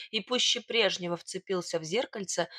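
ordinary delivery

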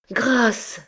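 high, pleading cry for mercy; desperate plea. A narrator's reading. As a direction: beg with everything left. Girl begs under torture. (supplication aiguë et désespérée)

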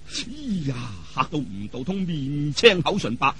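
ordinary delivery

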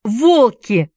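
high, loud shout of alarm; alarmed cry. A narrator's reading. Boy warning friends of wolves, alarmed cry. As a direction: warn them of the danger. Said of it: "закричал Уилл".

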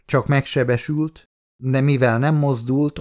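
ordinary delivery, nothing marked

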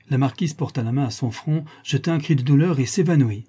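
usual speaking voice

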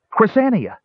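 high urgent call of her name. A narrator's reading. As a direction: call out to get her attention. Tass calls to the cleric.

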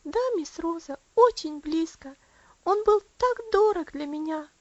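put-on high, loud, fervent child's voice; little Elsie, heartfelt. Elsie telling Rosa of her suffering, fervently. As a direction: pour it out as a child, stretching key words.

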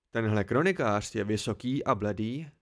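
ordinary delivery

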